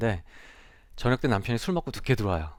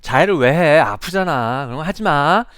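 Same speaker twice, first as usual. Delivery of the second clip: loud scolding advice; teacher lecturing a self-harming student, loud; nagging teacher; 4 semitones higher, 9 dB louder than usual. Retold or imitated. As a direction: tell the student off firmly. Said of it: "조언을 하기 시작하는 거죠".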